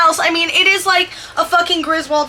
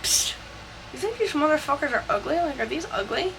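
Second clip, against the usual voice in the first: put-on hushed, sneaky kid-to-sibling murmur; conspiratorial aside; 10 dB quieter than usual. Conspiring behind mother's back.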